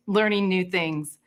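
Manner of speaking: ordinary talk, nothing marked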